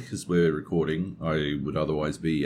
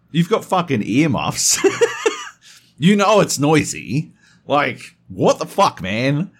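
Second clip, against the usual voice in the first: loud, higher, fed-up complaint; exasperated retort; 10 semitones higher, 8 dB louder than usual. Retold or imitated. Telling the neighbour off about the noise, loud and fed up. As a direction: call him out, annoyed. Confronting noisy upstairs neighbour.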